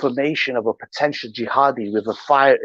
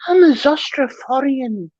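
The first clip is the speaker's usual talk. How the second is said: mocking Iranian man. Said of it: "He goes".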